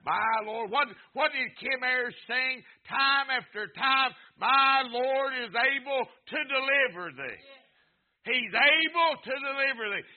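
loud, high, driving sermon delivery; preaching at full force. Acted. Preacher exhorts the congregation not to quit, full-force preaching. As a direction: preach it, building the fire.

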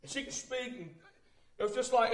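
ordinary talk

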